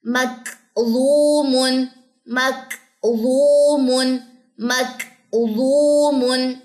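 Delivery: "مکظومن" very slow, breathy k then long held "ظو"; tajweed drill. Shown as a demonstration. Teacher modelling examples to repeat, drawn-out "مکظومن" with puffed k. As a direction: recite "مکظومن" syllable by syllable, stretching the middle. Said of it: "اس طریقے سے".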